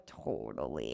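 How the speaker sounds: low flat mock-casual reply; mock nonchalant agreement; deadpan totally. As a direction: drop it low and unbothered.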